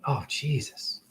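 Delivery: breathy whisper of despair; hushed disbelief; whispered dismay. Real, not acted. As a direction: whisper it, appalled. Thinking of tortured animals.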